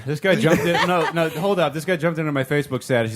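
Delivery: usual speaking voice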